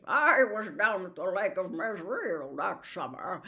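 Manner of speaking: talking warhorse, lofty and name-dropping; a vain old cavalry horse showing off; Bree the proud horse, boastful. Acted. Bree reminisces.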